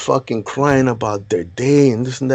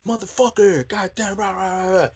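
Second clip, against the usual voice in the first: high, hammed-up yelling of rah rah rah; drill sergeant yelling; 8 semitones higher than usual. Drill sergeant screaming at a frozen recruit, high mock yell. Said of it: "up in his face yelling".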